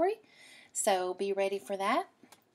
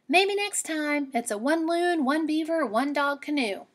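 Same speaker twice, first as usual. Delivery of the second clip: put-on girl character voice; 6 semitones higher than usual. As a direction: put the wolf off kindly. Girl refuses wolf, higher.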